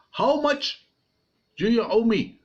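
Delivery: high, pushy king voice; king questioning his servant, high and demanding; king's demand. Acted. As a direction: press him for the money.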